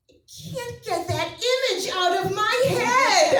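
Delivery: high, loud, slow wail; anguished daughter. Retold or imitated. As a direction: wail it like a scarred kid. Traumatized daughter wails.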